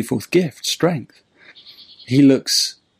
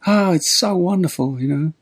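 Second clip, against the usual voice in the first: put-on little old man; 6 semitones higher than usual. Old man shares his tea ritual with tramp, high sweet voice. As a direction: gush sweetly in a higher voice. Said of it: "And the little old man goes".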